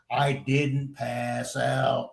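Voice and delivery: slow, word-by-word insistence; deliberate, exasperated correction; retold firm denial. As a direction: spell it out slowly to people not listening. Insists slowly he never fainted.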